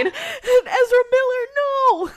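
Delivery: high, loud wail of mock horror; mock horrified shriek. Real, not acted. Host shrieking at a jump scare, high and loud. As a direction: cry out as if the scare got you.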